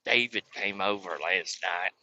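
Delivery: low, soft, slow grandma. Retold or imitated. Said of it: "Grandma doesn't talk like that".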